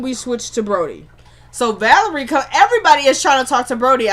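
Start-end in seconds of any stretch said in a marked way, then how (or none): 2.3-4.1 excited. Real, not acted. Excited recap of flirting.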